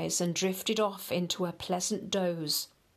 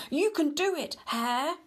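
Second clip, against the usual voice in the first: high encouraging call; cheering yell; 8 semitones higher than usual. Acted. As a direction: urge him on from the crowd. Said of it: "yells of".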